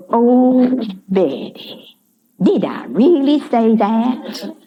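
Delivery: high, gently amazed Georgia Southern voice; a surprised old Georgia lady, drawn out; Georgia accent. Retold or imitated. Retired house mother answering Betty, in a high Georgia accent. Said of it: "in her sweet Southern way".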